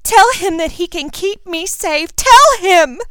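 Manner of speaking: high, loud, desperate insistence; distraught girl crying out; tearful pleading. A narrator's reading. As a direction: beg him, climbing to a wailed command. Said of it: "with tears in her".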